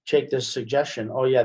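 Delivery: ordinary talk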